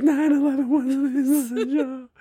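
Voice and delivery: high, slow, choking on laughter; laughing through it. Retold or imitated. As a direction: quote it while cracking up. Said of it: "Just crying through it".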